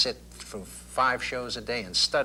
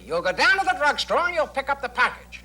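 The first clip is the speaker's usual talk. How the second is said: much higher, clipped and comic; exaggerated Smart voice; 5 dB louder than usual. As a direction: play the signature TV detective voice, clipped and high. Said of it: "take that voice and exaggerate it".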